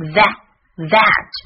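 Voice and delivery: "that" slowly, with a loud, open short a; vowel demo. Shown as a demonstration. Teacher drilling the vowel for learners, "that" slow and emphatic. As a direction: sound out the short a, then "that". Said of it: "A的发音是梅花音".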